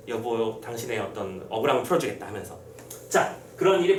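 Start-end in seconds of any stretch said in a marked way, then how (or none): 0.1-2.3 low, soft acted promise; hushed, low husband's voice, lightly acted; solemn vow. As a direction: quietly pledge it to a lost wife. Husband vowing at wife's memorial rite.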